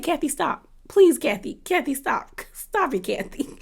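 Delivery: soft, helpless plea through laughter; cracking up. Retold or imitated. Viewer begging Kathy to stop, softly cracking up. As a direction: beg softly, as if too tickled to speak up. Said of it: "Like, I screamed".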